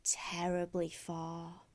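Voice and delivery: soft whispered drawl. Acted. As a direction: breathe it out lazily. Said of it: "drawled the wasp".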